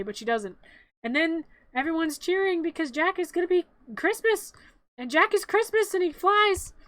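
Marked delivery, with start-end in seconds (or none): 1.0-6.7 high, louder triumphant retelling; playful elation, raised pitch; excited narrating. Real, not acted. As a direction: build to a cheer as he flies. Retelling the cheering, triumphant flight.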